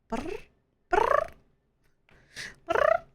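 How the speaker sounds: high trilled purr attempt, rolling lips. Shown as a demonstration. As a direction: try to purr but sound like a motor. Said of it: "Try purring?".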